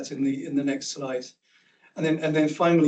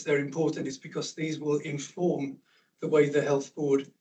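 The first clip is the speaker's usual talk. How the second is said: usual speaking voice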